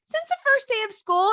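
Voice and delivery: very high-pitched kid imitation; daughter answering. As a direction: answer brightly as an innocent child. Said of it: "She goes".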